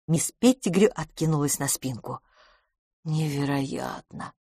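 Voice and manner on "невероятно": soft, slow, stunned murmur; faint. A narrator's reading. Miss Pettigrew sits back stunned, speaking faintly. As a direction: let it out weakly, drawn out. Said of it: "слабо сказала она".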